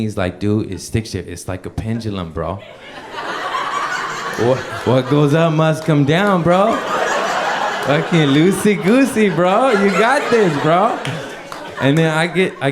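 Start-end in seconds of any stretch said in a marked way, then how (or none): 0.4-6.7 a hyped-up buddy cheering you on; bro coach. Retold or imitated. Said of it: "Adam's coaching me". 7.8-9.7 a hyped-up buddy cheering you on; bro coach. Retold or imitated. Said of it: "Adam's coaching me". 9.7-11.0 a hyped-up buddy cheering you on; bro coach. Retold or imitated. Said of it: "Adam's coaching me".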